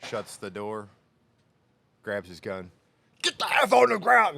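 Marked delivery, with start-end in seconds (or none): 3.2-4.4 much higher and louder barked order; cop yelling. Retold or imitated. Cop yells at the man lying down, a loud barked order. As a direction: yell it like an officer drawing his gun.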